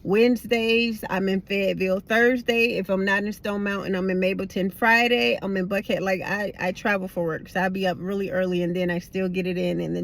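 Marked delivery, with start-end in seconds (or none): none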